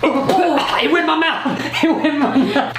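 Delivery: laughing while spluttering; laughing panic. Real, not acted. Foam in mouth, laughing.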